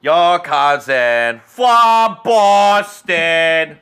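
loud, very slow mock Boston accent; Boston commercial impression. Mimicking the cousin from a Boston commercial, exaggerated Boston accent. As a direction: stretch out every word in a high, broad Boston accent. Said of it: "I hate that commercial".

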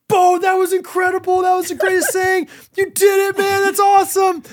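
high, loud over-the-top praise; hyping the kid. Retold or imitated. Dad hyping up his scared son after a crash, excited cheer. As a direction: cheer him on like a camp counselor. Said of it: "I'm like".

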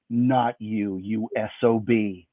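low, soft, slow menace; grown-up Sarah menacing the magician. Pretend. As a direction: growl it like a showdown.

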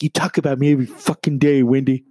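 lightly voiced angry diva. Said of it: "[name] is like".

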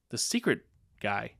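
ordinary talk, nothing marked

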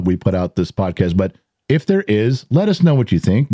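ordinary talk

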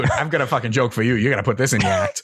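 fast, rowdy barroom fan pitch; pushy Boston fan. Retold or imitated. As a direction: corner the comic like an eager local.

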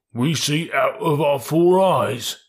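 drawn-out bullock reply; stolid bullocks. Acted. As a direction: insist slowly and simply. Bullocks answer Two Tails.

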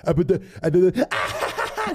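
high, stuttering imitation of nonsense; demonic drunken babbling. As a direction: babble broken syllables like a stoned brother. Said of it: "saying random words that made no sense".

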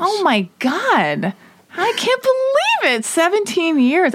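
slow, high-pitched shock; stunned disbelief. Real, not acted. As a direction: let the disbelief lift the voice way up. Reacting to seventeen-year marriage.

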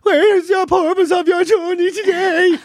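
high, slow, drawn-out official question; mock young policeman, every word stretched; young guard. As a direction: imitate a youthful guard trying to sound official. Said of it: "they're so young".

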